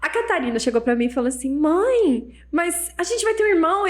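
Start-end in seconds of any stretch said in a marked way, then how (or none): 1.6-3.9 higher-pitched imitation of a daughter; child to mother. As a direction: lightly voice a child addressing mum. Filha fala com a mãe. (imitando a filha, voz aguda)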